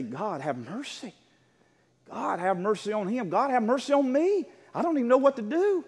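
helpless plea building from a hushed start; helpless pleading. Retold or imitated. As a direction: plead for mercy, pitch rising line by line. Retelling his helpless prayer in hospital.

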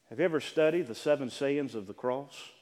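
ordinary talk, nothing marked

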